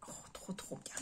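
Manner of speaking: breathy whisper of delight. Real, not acted. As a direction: breathe it out, charmed. Whispered delight over stamps. (murmure ravi)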